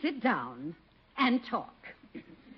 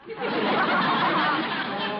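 loud amused laugh; 6 semitones higher, 6 dB louder than usual. Acted. Martha laughs at Edward.

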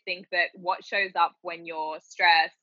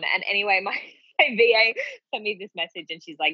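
ordinary talk

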